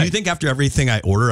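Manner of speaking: ordinary talk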